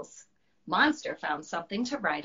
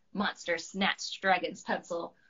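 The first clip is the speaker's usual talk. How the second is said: ordinary delivery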